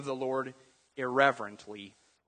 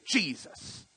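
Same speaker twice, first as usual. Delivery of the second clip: annoyed curse; 5 dB quieter than usual. Shown as a demonstration. Someone angered using the name, softer irritated oath. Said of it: "when something makes you mad".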